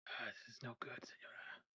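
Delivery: hushed worried whisper. Acted. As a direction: whisper over a hurt friend. Worried whisper over injured friend.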